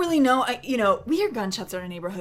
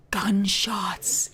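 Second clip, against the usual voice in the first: sharp gasp, then whispered word; gasp, then breathe the word out. Retold or imitated.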